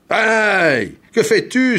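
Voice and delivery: high, loud yelp of pain; pained cry. A narrator's reading. Governor whose skull was just pierced, crying out. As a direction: cry out in shock. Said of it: "s'écria Gong Jing".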